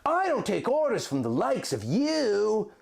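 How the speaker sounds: sneering. Acted. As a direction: spit it back at the villain with scorn. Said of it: "Link sneered back".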